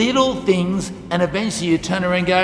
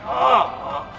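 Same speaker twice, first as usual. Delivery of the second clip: mock pained groan; 6 semitones higher than usual. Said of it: "so much pain and staggering".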